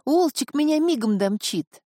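put-on high, eager girl's voice; flustered bustle. A narrator's reading. Girl offers to ski behind the wolf, flustered eagerness. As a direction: pitch it up, fussing to get going. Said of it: "засуетилась девушка".

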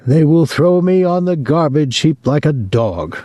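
mother complaining she will be discarded; self-pitying mother. Acted. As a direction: wallow in self-pity.

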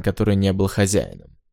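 ordinary delivery, nothing marked

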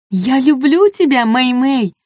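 high joyful squeal; shrieking jackpot winner. A narrator's reading. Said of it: "завизжала та от радости".

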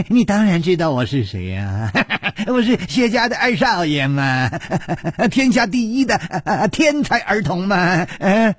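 high giggly madman boast; giggling boast. Acted. Xue bragging about being the genius son, high giggling. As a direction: giggle between the words like a spoiled child.

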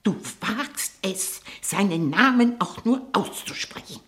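how dare you, rising fury; outraged. Acted. Tante empört über den Namen. (Tante empört sich, dass die Nichte den Namen ausspricht)

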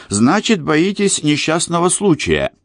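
high teasing mockery; mocking. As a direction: needle him, poking fun. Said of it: "В голосе Коры слышалась издёвка".

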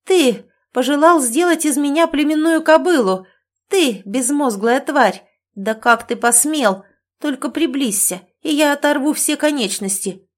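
high, loud outburst of rage; furious yell. A narrator's reading. Woman rages at the man who insulted her, furious yell. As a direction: lash out in fury, pitch jumping high. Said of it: "яростно заорала".

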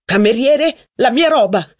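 loud angry call. Acted. Robert angrily calling the waiter for his things. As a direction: shout for the waiter. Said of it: "esclamò Robert".

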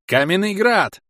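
high, loud gleeful spell cry; joyful shout. A narrator's reading. Warrior joyfully shouting his spell from above, gleeful cry. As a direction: yell the spell out with delight. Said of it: "радостно закричал храбр".

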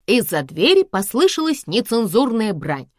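usual speaking voice

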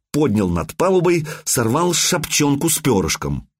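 ordinary delivery, nothing marked

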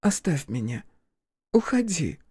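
put-on faint, strained girl's plea; begging him to go, weakly; weak pleading girl. Verochka begs him to leave.

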